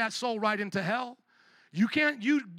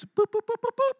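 high, squeaky popping boops; 14 semitones higher, 5 dB louder than usual. Retold or imitated. Preacher mocking claims popping up, with squeaky boops. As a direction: make little pops like things springing up.

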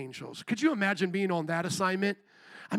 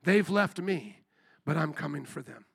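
much softer, lower, tender resolve; tender Jesus; 4 semitones lower, 5 dB quieter than usual. Pretend. Jesus promising to come for mankind, soft tender voice. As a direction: hush it down like a loving promise. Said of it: "But Jesus says".

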